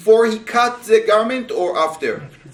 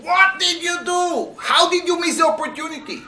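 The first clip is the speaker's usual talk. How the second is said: shouting; 11 semitones higher than usual. Retold or imitated. The men shout at David, high and outraged. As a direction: throw the accusation at him. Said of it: "they are shouting".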